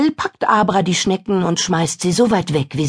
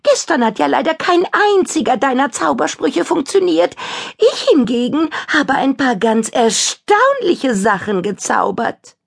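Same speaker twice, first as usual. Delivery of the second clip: high taunting witch; 10 semitones higher than usual. Acted. Kadabra keeps taunting.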